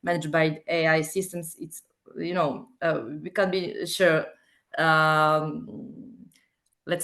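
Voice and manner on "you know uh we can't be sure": ordinary talk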